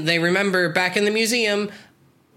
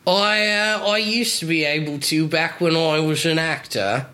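Michael Caine style Cockney; Cockney accent. Shown as a demonstration. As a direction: talk in a stagey Cockney accent. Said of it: "can you do a Cockney accent?".

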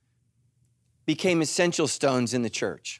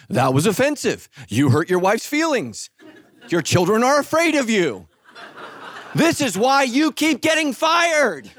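mock scolding car; 6 semitones higher, 3 dB louder than usual.

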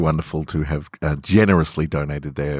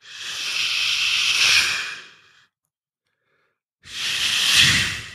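breathy unvoiced shushing; gentle unvoiced shh. Shushing in dark hotel room.

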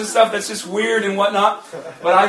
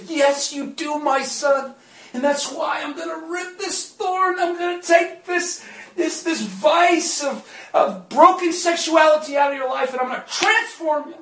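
God promising, voiced higher than the preacher; imagined voice of God; 9 semitones higher, 3 dB louder than usual. The Lord answering the preacher's hopeful prayer, higher voice. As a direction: speak it as a kind, higher God. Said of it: "hopefully, the Lord would say".

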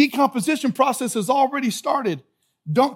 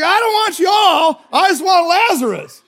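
comic holler; 8 semitones higher, 7 dB louder than usual. Acted. Jesus telling the other dead to stay put, comic holler. Acts Jesus calling into tomb.

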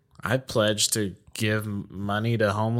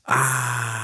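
a short cartoonish pained ah; Peter Griffin groan. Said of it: "that Peter Griffin thing".

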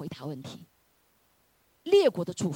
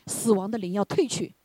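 ordinary delivery

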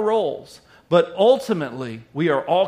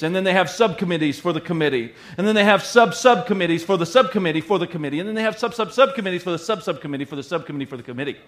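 fast, practised rattle, speeding up toward the end; rattled-off tongue twister; faster than usual. Shown as a demonstration. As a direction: race through the nested phrase without stumbling. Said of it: "I practiced that in the mirror".

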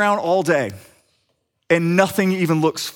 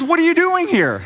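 astonished question; 12 semitones higher than usual. Retold or imitated. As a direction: imitate a friend caught totally off guard. Stephen astonished at the door.

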